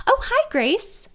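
high, slow and cheery greeting; happy receptionist. Acted. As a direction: greet her like an old friend. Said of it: "she says happily".

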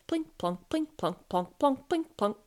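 crisp, rhythmic drip sounds; rain-drip words. A narrator's reading. Narrator voices drips landing in pans, rhythmic drip words. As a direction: say each word like a single drop.

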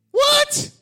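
a sudden high, loud "What?"; astonished high yelp; mock amazement. Real, not acted. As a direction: shout it out in disbelief. Preacher exclaims in amazement.

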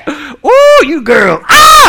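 high, loud squeals of delight; mock-smitten whooping. Waiter thrilled by birthday lady's tip, mock-smitten whooping. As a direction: swoop up high and cry out, hammed-up.

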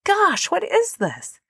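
high, slow, awestruck exclamation; retelling being mind blown, slow and high; mind-blown wonder. Retold or imitated. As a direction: replay the moment it all clicked, high and slow. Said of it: "mind blown".

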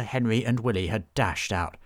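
plain reading voice

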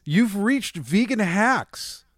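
slow, drawn-out show intro; announcer voice. Acted. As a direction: announce it like a host.

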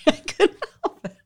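high-pitched, giggling through the words; can't stop laughing; laughing apology. Real, not acted.

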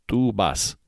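normal talking voice

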